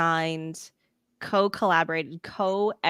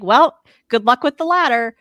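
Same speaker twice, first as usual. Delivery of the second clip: sarcastic retort; 5 semitones higher, 9 dB louder than usual. Retold or imitated. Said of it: "I'm like".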